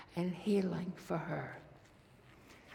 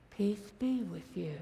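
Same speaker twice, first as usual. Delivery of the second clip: ordinary talk, nothing marked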